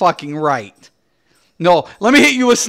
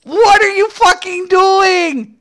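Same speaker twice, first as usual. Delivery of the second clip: exasperated; 10 semitones higher, slower than usual. Real, not acted. Ranting at game devs.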